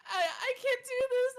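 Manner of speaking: hammed-up crying cashier; imitating crying. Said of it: "she was like".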